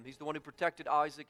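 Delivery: normal talking voice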